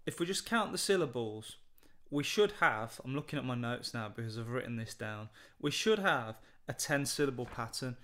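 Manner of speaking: ordinary talk, nothing marked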